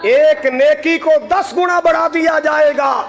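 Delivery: impassioned, ringing proclamation; fervent preaching. Real, not acted. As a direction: drive it out to the congregation like a preacher. Preaches at full force.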